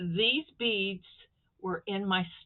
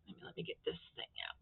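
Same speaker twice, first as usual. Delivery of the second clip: quick, breathy mutter while fumbling with the brooch; near-whispered struggle as fingers fail; straining under breath; 2 semitones lower, 15 dB quieter, much faster than usual. Real, not acted. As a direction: murmur through the fiddly effort. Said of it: "My fingers aren't working".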